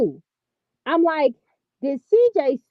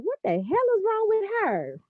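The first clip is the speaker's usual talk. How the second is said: baffled reaction; 9 semitones higher, 4 dB quieter than usual. Retold or imitated. Reacting to her own impression.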